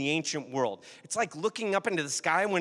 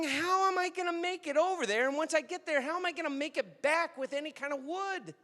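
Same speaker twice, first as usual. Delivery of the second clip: put-on young boy voice; 12 semitones higher than usual. Boy Jason calling out to grandpa, high and frantic. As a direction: play the boy lost in the drifts, pitched up high. Boy clawing through snow.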